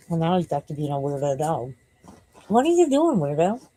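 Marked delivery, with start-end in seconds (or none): 2.5-3.7 high dog-talk voice. Real, not acted. Talking to the dog.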